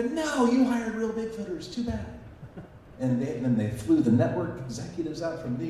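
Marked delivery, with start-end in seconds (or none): 0.0-2.0 smug retort. Retold or imitated. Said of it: "And go".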